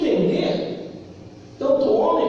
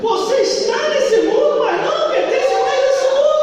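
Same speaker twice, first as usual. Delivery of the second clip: high, loud, chanted preaching; preacher to the church, raised and chanted; full-force preaching; 9 semitones higher, 4 dB louder than usual. Acted. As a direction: lift it into a sung cry.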